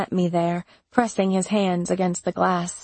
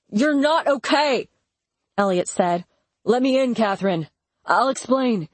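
urgent plea; 4 semitones higher than usual. A narrator's reading. Elliot pleads through door.

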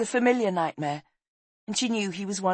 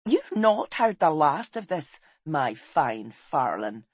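ordinary delivery, nothing marked

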